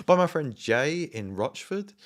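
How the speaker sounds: high, slow, loud innocent answer; loud sing-song high answer, drawn out; mock naive reply. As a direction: act like he should obviously know Jay.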